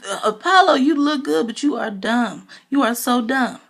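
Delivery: high, fast exasperated scolding; mock exasperated rant. Speaker scolding Apollo in her head, high and fast. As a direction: scold him like he's hopeless, quick and high. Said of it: "I'm thinking".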